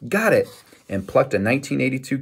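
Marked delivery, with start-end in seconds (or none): 0.1-0.5 high-pitched triumphant call, only mildly raised volume; narrated shout of victory. A narrator's reading. Boy finding his coin before his brother, shouted. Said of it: "just as Kyle shouted".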